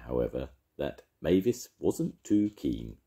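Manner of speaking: ordinary delivery, nothing marked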